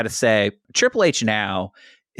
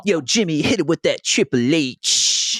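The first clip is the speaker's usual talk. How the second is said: hype man voice; 5 semitones higher than usual. Retold or imitated. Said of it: "So he's like".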